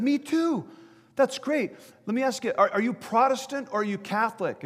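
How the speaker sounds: high-pitched, eager acted delight; excited calling. Bystander to jumper sharing his faith, excited calling. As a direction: call out, thrilled at common ground.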